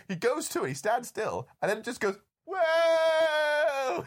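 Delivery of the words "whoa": high hammed-up whoa; mock whoa. Sean Connery yelping as he falls into the tabernacle, hammed-up. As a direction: yelp like on a roller coaster. Movie character falling in.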